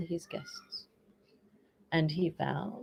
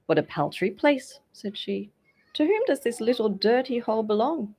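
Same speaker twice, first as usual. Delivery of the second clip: louder, higher disgust; disdainful princess; 6 semitones higher, 6 dB louder than usual. A narrator's reading. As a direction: scorn the cottage.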